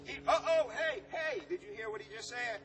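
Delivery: high, loud mock alarm; uh-oh, call the class to listen; surprised. Real, not acted. Teacher calling out a student's wrong answer, surprised.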